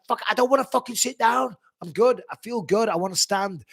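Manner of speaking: retold stubborn protest; defiant refusal. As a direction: push back hard at the coach. Retold quarrel with his coaches.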